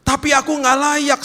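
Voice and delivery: high, loud objection; hammed-up protest pitched far up; protesting sinner. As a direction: cry out your unworthiness. Acted sinner objecting once more.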